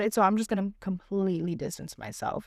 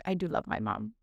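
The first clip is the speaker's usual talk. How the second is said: much lower and softer, a hushed inner admission; quiet realization; 12 semitones lower, 8 dB quieter than usual. Retold or imitated. Daughter recalling the moment she realized she loves her mom, hushed. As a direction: say it low, almost to yourself.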